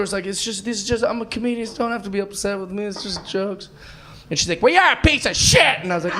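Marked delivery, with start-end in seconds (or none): 0.3-3.7 softer, apologetic explaining; placating. Retold or imitated. Comic retelling his explanation to an upset lady, soft placating. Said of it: "I'm trying to explain to her". 4.6-5.8 high-pitched mock outrage; hammed-up irate heckler, voice shooting up; angry lady. Comic imitating the lady who went bananas, high furious insult. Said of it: "this one lady went bananas".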